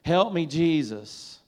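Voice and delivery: loud slow plea. Shown as a demonstration. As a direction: cry out "Help me, Jesus" slowly and loudly. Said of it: "I want you to try saying something".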